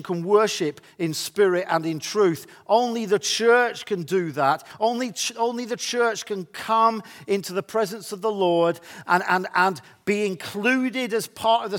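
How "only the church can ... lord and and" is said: ordinary delivery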